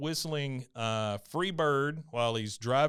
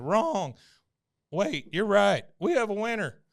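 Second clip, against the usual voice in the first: high, punchy verdict turning celebratory; mock announcer reversal; 8 semitones higher, 4 dB louder than usual. Cashier checks the scoop weight, then announces a winner, high-pitched. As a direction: call it out like a prize announcement.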